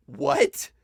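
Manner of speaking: high-pitched, rising "what"; re-enacted astonishment; retold disbelief. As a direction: blurt a stunned question. Retold reaction to the verse.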